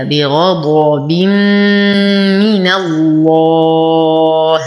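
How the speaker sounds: long level hum into من, then a drawn-out pausal الله; idgham with ghunnah. Shown as a demonstration. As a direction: chant بغضب من الله slowly, merging into a long nasal m. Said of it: "إدغام مع غنة كاملة". (ترتيل بغضب من الله بإدغام وغنة كاملة)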